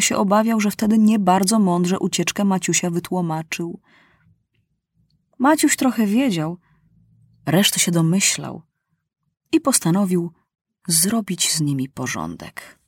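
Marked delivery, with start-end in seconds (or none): none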